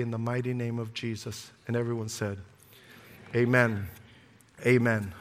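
soft, hushed opening prayer. Acted. Pastor praying softly over congregation, hushed. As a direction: pray it quietly to God.

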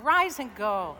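loud congregational call; rousing call again. Shown as a demonstration. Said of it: "One more time".

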